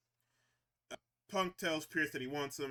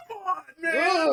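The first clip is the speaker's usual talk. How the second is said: much higher, louder, slow hype shout; excited shout; 11 semitones higher, 11 dB louder, slower than usual. Real, not acted. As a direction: yell it out in sheer hype. Hyped shout at Punk promo.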